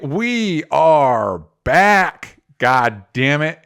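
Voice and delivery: ordinary talk, nothing marked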